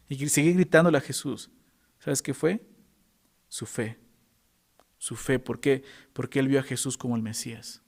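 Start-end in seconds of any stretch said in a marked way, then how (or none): none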